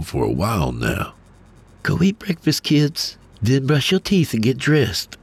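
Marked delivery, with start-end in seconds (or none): 1.8-5.2 much higher, lightly voiced mother giving orders; a mom sending kids off, brisk and bright; wife instructing kids. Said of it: "she instructed".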